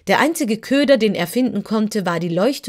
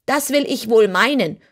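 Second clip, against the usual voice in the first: higher, louder piglet voice with a grunt; 4 semitones higher, 5 dB louder than usual. Acted. Piglet agrees with Dorothy, grunting. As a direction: grunt it like a worried pig. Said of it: "grunzte ein anderes der Ferkel".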